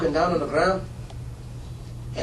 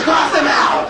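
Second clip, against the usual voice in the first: loud, higher shouted order; 10 semitones higher, 8 dB louder, slower than usual. Retold or imitated. A classmate shouts the order to cross the kid out. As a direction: bark it like a final verdict.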